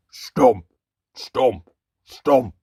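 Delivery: loud thudding stomps; heavy stomps. A narrator's reading. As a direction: pound out each footstep. Giant footsteps approaching.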